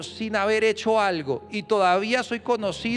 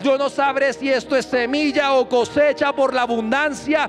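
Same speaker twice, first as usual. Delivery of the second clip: rising, louder declaration, climbing higher at the end; preaching at full force; 4 semitones higher, 3 dB louder than usual. Acted. Pastor leads the church in bold faith declarations. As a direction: proclaim it over the church. Declarations building over music.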